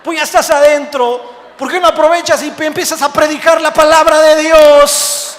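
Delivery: loud, high preacher's shout, acted as God; forceful rebuke. God challenges the jailed pastor to preach, forceful rebuke. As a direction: hammer the challenge home at full volume.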